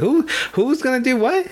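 a startled Scooby-Doo yelp. Retold or imitated. Scooby balks when asked to cross the ropes, in a high cartoon dog voice. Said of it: "And Scooby's like".

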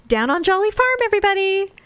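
high singsong presenter voice; kids' TV host. Pretend. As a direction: sing out the show title. Continues kids' show bit.

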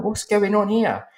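very high, drawn-out bewildered question; bewildered alarm. Retold or imitated. Onlooker turning to writer and producer as a row starts, high bewilderment.

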